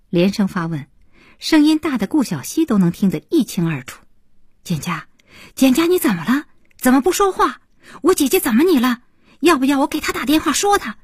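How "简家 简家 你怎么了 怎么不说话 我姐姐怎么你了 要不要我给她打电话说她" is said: high-pitched, quick volley of worried questions; anxious questioning. A narrator's reading. Young man frets over the phone at his upset friend, anxious and insistent. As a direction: press urgently for an answer, pitch up high. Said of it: "显然小航在那头急了，连声发问，声音大的".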